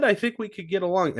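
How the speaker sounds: high eager golly-gee voice; sweet, naive and very high; wholesome 1950s kid. Retold or imitated. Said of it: "Dark Cousins being like".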